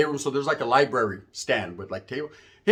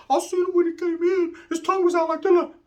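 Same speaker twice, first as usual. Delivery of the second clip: very high, thin put-on voice; squeaky aside; 14 semitones higher than usual. Inmate signalling back that he saw him, high squeaky voice. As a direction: squeeze it out high.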